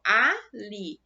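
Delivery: "ali" said slowly as a-li; split into syllables. Shown as a demonstration. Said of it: "quando eu fiz a minha separação silábica".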